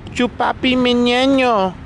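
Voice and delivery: high, slow goofy holler; silly catchphrase call. Pretend. Teasing after being told to stop, silly high call. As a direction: sing it out high and stretched.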